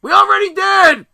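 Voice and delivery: very high, loud retort; full-volume high-pitched mock yell; yelling back. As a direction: imitate a kid screaming back. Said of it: "everybody's yelling at me".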